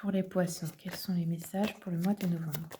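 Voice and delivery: hushed whisper to the cards; whispered question. Real, not acted.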